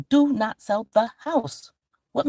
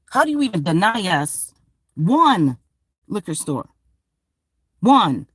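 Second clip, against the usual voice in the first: normal talking voice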